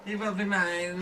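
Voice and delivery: mock kid voice. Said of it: "repeating the quote, laughing".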